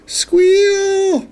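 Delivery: very high, drawn-out squeal; imitated squeal. A narrator's reading. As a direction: hold the word up high like an animal's squeal. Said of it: "Then I heard it again".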